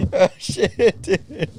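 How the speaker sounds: helpless high laughter breaking through the words; real fit of laughter, squeaking out the words; laughing meltdown. Real, not acted. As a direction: crack up so hard the voice goes up high and loud. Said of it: "We got a meltdown going on here".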